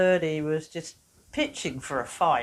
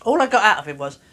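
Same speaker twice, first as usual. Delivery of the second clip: ordinary talk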